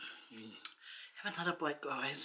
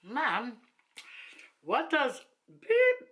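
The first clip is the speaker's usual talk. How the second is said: higher, slow, halting question; daughter's voice; 6 semitones higher, 3 dB louder, much slower than usual. Retold or imitated. Daughter home asking mum about a swear word, higher imitated voice. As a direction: imitate a child carefully asking, swear word swapped for a beep. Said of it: "it's funny when she comes home".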